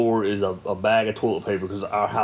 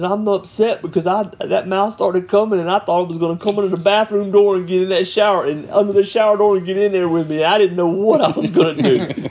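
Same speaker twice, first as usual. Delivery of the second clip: put-on higher, fast, worried child's voice; little girl explaining; 8 semitones higher than usual. Daughter telling dad why she was scared, high and fast. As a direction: rush it like a worried kid.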